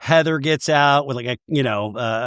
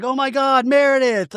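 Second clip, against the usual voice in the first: dramatic gasp; 10 semitones higher than usual. Retold or imitated. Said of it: "it's like".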